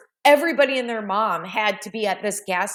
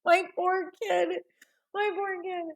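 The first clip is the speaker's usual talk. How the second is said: laughing pity; 10 semitones higher, 5 dB quieter than usual. Real, not acted. Laughing over son's embarrassment.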